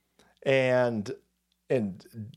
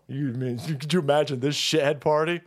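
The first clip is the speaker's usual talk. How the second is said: ordinary talk, nothing marked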